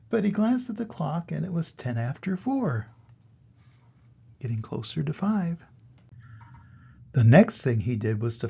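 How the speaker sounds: lively storybook read-aloud; reading to children. A narrator's reading. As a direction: read the rhymes to little kids. Grandpa reads rhyming picture book.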